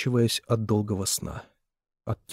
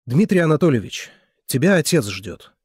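loud, higher accusing call; raised voice; 5 semitones higher, 6 dB louder than usual. Acted. Wife calling out the lover, loud and sharp. Character's angry announcement in story.

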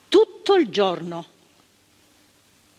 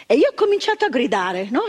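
ordinary talk

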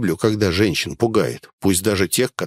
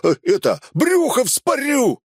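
stumble, then shriek the threat high; high, desperate bandit yell; 9 semitones higher than usual. A narrator's reading. Cornered bandit threatening the hostage, yelling. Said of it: "заорал он".

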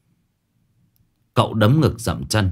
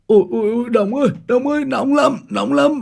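high panicked cry of pain, hot hot; pained screaming; 3 dB louder, faster than usual. Acted. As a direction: shriek like you're on fire. Burned man screaming in pain. (kêu thét đau đớn, giọng cao hoảng loạn)

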